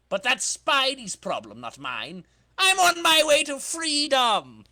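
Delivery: cocky crook yelling in triumph; gloating villain. Acted. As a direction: crow about getting away. Villain gloating while escaping.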